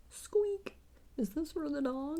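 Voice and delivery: high-pitched mock question to a dog; imitated squeaky brother. Brother asking about the dog toy, high imitated squeak.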